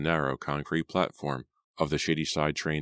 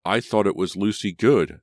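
stammering, louder and higher; sputtering; 4 semitones higher, 6 dB louder than usual. Acted. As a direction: blurt it out in shock. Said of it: "Simon sputtered".

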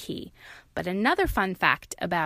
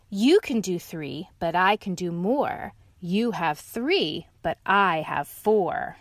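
slow, loud boast; one-up the lion; loud, slow bragging dog; 3 dB louder, slower than usual. Acted. Dog brags.

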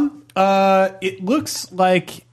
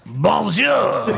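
big hammed-up French bomb greeting; French accent; 6 semitones higher than usual. Bomb with eyes greeting the players, hammed-up French accent. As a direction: call it out in a mock French accent. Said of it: "It's got eyes and a French accent".

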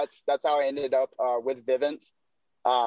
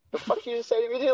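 mock-offended retort; 10 semitones higher, faster than usual. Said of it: "And I was like".

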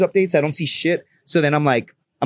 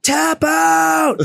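very high, drawn-out mock shout; imitated yell; 13 semitones higher than usual. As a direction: holler it like a bystander from afar. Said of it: "some dude yelled out".

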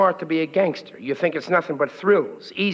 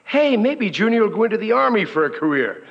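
usual speaking voice

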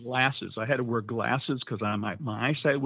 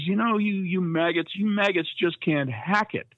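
drill instructor yelling; 8 semitones higher than usual. Retold or imitated. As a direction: bark it at the recruits like a DI. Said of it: "the drill instructor's yelling at us".

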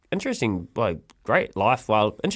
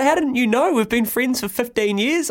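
mate's reply; 11 semitones higher than usual. Retold or imitated. Mate voiced in story.

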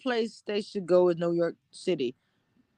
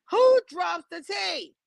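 slow, higher, demanding call-out; playful diva demand; mock outrage; 9 semitones higher, slower than usual. Real, not acted. Playful challenge to viewer.

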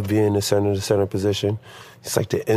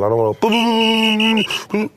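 high rapid whistle toots; mock the over-eager whistle; 11 semitones higher than usual. Shown as a demonstration. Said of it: "I wanna be sharp".

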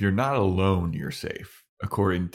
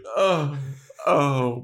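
tragic meltdown; 6 semitones higher than usual. Retold or imitated. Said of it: "a Shakespearean tragedy meltdown".

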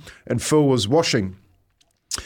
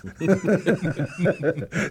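soft wheezing laugh after the story; quiet breathy laugh; real laugh; 5 semitones higher, 3 dB quieter than usual. Real, not acted. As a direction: let the giggle escape. Laughing at the memory.

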